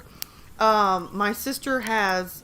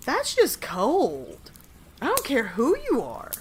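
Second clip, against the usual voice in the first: very slow, swooping emphatic verdict; drawn-out disapproval; 4 semitones higher than usual. Real, not acted. Streamer reacting to a birthday breakup story, drawn-out disapproval. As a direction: stretch each key word in sympathetic outrage.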